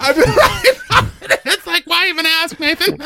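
high laughing speech. Real, not acted. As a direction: laugh through the words. Laughing through words.

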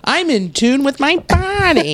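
nasal, slightly stuffy voice. Real, not acted. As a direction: talk through a tight nasal voice. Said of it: "I felt me get more nasally".

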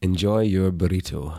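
whispery Scottish sign-off; hushed, intimate Scottish delivery; Scottish accent. Shown as a demonstration. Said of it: "tell people Scottishly to enjoy their burrito".

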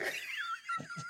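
a squealing laugh way up high. Real, not acted.

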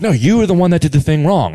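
mildly heated retold comeback; retold arguing. Acts out retold quarrel.

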